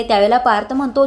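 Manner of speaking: plain reading voice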